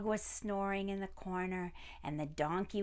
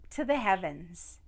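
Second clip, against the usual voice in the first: usual speaking voice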